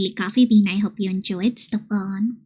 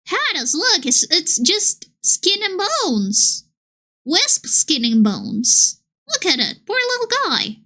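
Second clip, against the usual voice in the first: put-on pitying baby talk; 7 semitones higher than usual. Streamer to friend's starving wisp, high pitying coo. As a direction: coo over a skinny little pet.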